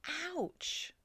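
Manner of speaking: high pained yelp; a sharp squeal of pain; screamed. A narrator's reading. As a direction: pig yelping as it wakes. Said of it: "he screamed".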